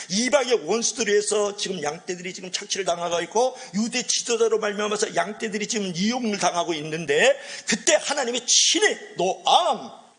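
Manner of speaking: high, emphatic sermon delivery; impassioned preaching. Real, not acted. Preacher telling how God feeds the exploited flock, impassioned preaching. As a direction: preach it with rising force, driving home the key words.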